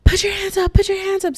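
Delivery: high-pitched mock police yell; loud retold command, voice shot up; imitated screaming. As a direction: scream it like cops giving orders. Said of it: "they're just screaming".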